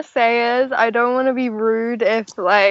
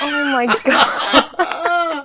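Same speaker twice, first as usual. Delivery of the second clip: higher, slow, laughing exclamation; cracking up at a joke; helpless laughter; 5 semitones higher, much slower than usual. Real, not acted. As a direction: gasp it out through real laughter.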